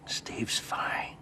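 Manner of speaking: reassuring whisper. Acted. Husband reassuring wife, whispered. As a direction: soothe her softly. Hushed late-night talk.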